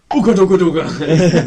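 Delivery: loud, slow ape chant; comic gorilla. Acted. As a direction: boom it out like a cartoon gorilla. Barman revealed as gorilla, acted.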